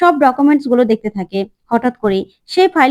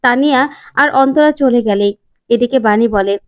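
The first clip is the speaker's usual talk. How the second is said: plain reading voice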